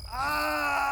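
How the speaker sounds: short high yelp; a startled little scream, hammed up; a quick fake shriek for the video; mock yell. Shown as a demonstration. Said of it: "That's me yelling".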